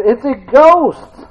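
mock terrified shout; frightened cry. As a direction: slowly cry out as if seeing a ghost. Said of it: "they cried out in fear".

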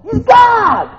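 high, loud preaching shout; drawn-out exultant shout; triumphant proclamation. Real, not acted. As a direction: belt it out as the climax. Climax of preaching on scripture.

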